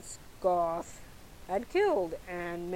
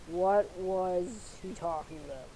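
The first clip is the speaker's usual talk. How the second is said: usual speaking voice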